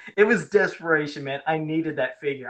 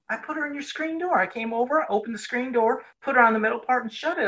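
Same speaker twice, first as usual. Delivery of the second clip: high, fast protest of innocence; boy lying to his girlfriend about her She-Ra; faked innocence; 7 semitones higher than usual. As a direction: pretend you did nothing wrong. Kid faking innocence to girlfriend.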